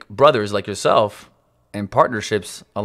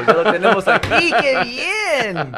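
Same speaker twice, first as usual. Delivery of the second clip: laughing; 12 semitones higher, 4 dB louder, slower than usual. Real, not acted. Reacting laughing to applause sound.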